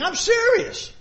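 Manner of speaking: a pastor voicing God's insistence; emphatic insistence. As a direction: jump up to drive it home. God insisting, imagined.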